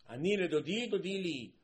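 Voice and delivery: high, forceful proclamation of a verse; loud declaiming. Acted. Preacher quoting scripture to listeners, loudly proclaimed.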